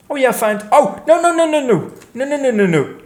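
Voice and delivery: real dismay at a sudden mishap; oh no, not again. Real, not acted. Something goes wrong in the lorry.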